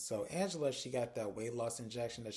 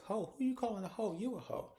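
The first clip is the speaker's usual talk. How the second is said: pitched-up sassy retort; indignant comeback; 9 semitones higher than usual. Retold or imitated. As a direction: throw the insult straight back, higher. Belinda fires the insult back.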